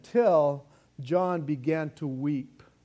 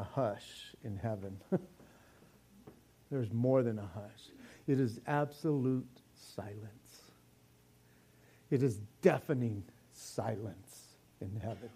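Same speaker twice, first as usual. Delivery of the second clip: hushed; 7 semitones lower, 9 dB quieter than usual. Real, not acted. Describing the hush in heaven.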